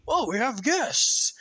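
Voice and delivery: noticing guests for the first time; pleasantly surprised. Acted.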